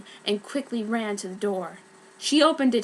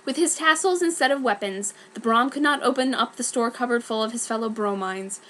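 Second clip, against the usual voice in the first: plain reading voice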